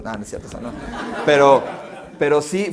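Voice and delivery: laughing off his own joke. Real, not acted. Pastor admitting his exaggeration, laughing. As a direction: chuckle through it.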